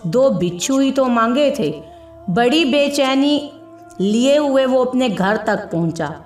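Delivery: plain reading voice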